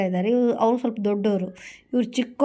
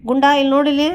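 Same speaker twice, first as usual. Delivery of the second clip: louder, higher call to a kitten; calling a pet; 4 semitones higher, 7 dB louder than usual. Real, not acted. Owner calling Gunda to look here, loud pet call. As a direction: call out to get the kitten looking.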